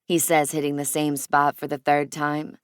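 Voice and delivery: ordinary delivery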